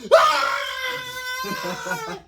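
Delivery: very high loud shriek; hammed-up howl of pain; mock scream. Boy shrieking after the belt hits him, very high.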